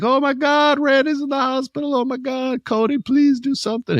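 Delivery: very high falsetto wife wailing for help; distraught wife. Retold or imitated. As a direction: plead in a hammed-up, squeaky woman's voice. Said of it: "Randy's wife is like".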